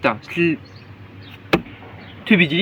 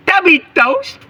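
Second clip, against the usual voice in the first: higher and slow; lightly cutesy name call; cute character voice; 5 dB louder, much slower than usual. Pretend. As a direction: stretch the name out in a cutesy put-on voice.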